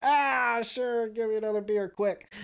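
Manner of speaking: high mock tipsy brush-off; tipsy himself. Speaker brushes off the guest at the bar, high tipsy voice. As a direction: shrug him off and grab a beer. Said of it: "And I'm like".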